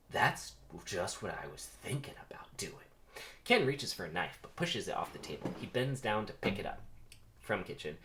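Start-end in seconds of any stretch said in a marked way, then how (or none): 0.1-2.7 hushed menacing whisper; threatening whisper. Shown as a demonstration. Ken threatening Barry over dinner, menacing whisper. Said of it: "oh, that's a threat".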